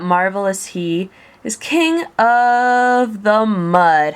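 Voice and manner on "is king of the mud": mocking drawn-out phrase. A narrator's reading. Mocking Yertle's fall.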